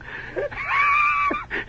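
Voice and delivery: long, squealing giggle; high wheezing laugh. Real, not acted. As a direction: break up in a high-pitched cackle. Storyteller breaks up mid tale.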